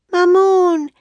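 high, loud drawn-out call; Annie calling. A narrator's reading. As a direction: call far out for someone missing. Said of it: "Annie called".

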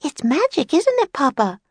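put-on high little girl voice; young girl. A narrator's reading. Girl asking her Papa to agree, high young girl's voice. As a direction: pitch it up like a delighted child. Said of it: "said the girl".

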